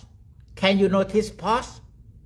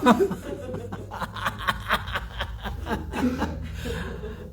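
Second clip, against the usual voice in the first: breathy, pulsing laughter that keeps going; long giggling laugh; 3 semitones higher, 4 dB quieter than usual. Real, not acted. Teacher tickled by own husband joke, long laugh.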